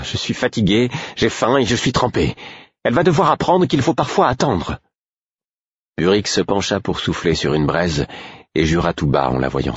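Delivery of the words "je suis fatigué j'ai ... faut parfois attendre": high, rising, fed-up litany; exasperated complaint. A narrator's reading. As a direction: pile up the grievances, pitch climbing. Molly complains about the crying baby. (plainte exaspérée, voix qui monte)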